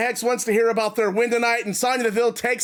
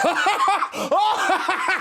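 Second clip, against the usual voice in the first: mad laugh; 8 semitones higher than usual. Acted. [name] laughs before screaming.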